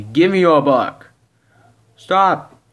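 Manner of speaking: loud, high command; urgent demand. Acted. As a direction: shout to make them stop. Acted struggle, demanding.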